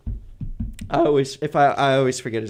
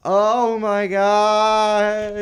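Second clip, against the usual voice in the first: drawn-out shock; 8 semitones higher, 5 dB louder, much slower than usual. Retold or imitated. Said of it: "and it's just like".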